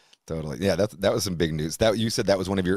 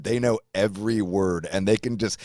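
ordinary delivery

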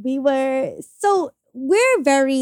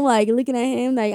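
ordinary talk, nothing marked

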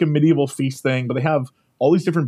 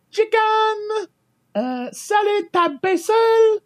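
very high, slow sing-song announcer; train announcer; much slower than usual. Retold or imitated. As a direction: drag out the menu like the attendant. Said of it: "she'd be like".

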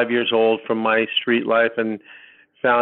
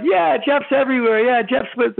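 much higher, breezy put-on voice of carefree concertgoers; mocking the friends; 13 semitones higher than usual. Friends brushing off his panic, high mocking voice. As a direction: toss it off like partying friends humoring him.

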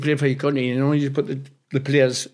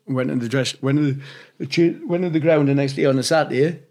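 ordinary delivery

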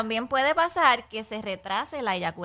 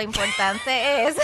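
laughing; 6 semitones higher, 5 dB louder, slower than usual. Real, not acted. Laughing mid-explanation.

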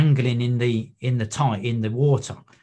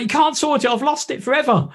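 much higher, frantic imitated despair; panicked protest; 13 semitones higher than usual. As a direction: wail that it's gone for good. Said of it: "And I'm panicking".

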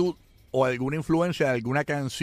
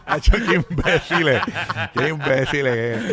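laughing; 3 semitones higher, 5 dB louder, slower than usual. Real, not acted. Laughing insults at co-hosts.

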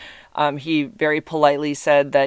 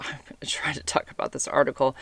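whispering; 2 semitones lower, 6 dB quieter, faster than usual. Real, not acted. Aside whisper while laughing.

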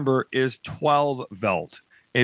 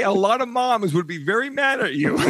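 laughing; 6 semitones higher than usual. Real, not acted. Teasing host, laughing.

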